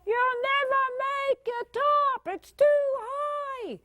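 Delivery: high, drawn-out mock shout; mock yelling out. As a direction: call up from below in a stretched high voice. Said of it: "we're yelling out".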